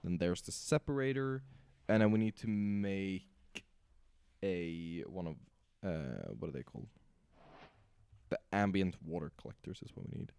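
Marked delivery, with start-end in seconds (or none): none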